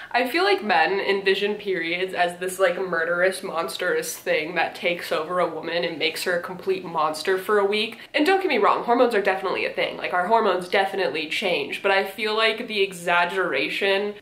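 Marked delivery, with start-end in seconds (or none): none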